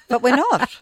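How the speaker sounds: amused admission with a real laugh. Real, not acted. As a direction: say it while cracking up. Both hosts laughing at themselves.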